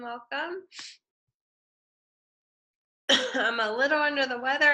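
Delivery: ordinary talk, nothing marked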